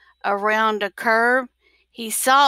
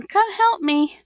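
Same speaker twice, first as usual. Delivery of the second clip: pleading call; 8 semitones higher than usual. A narrator's reading. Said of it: "he's saying".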